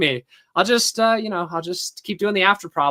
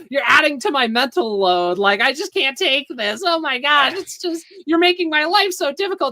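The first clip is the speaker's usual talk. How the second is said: higher, whiny put-upon voice; imitating an overwhelmed victim; a hint of a hammed-up whiner; mock whiny complaint; 5 semitones higher than usual. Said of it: "start going".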